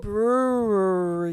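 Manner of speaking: Brewery drawn out slowly, every syllable; slow careful brewery. Shown as a demonstration. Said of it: "I say that right".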